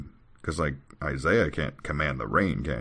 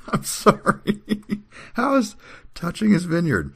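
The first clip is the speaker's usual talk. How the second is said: high, helpless laughing speech; squeaking the words out while laughing; cracking up; 5 dB louder than usual. Real, not acted. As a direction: talk through a real laughing fit. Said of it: "try to read this without laughing".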